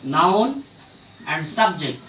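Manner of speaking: ordinary talk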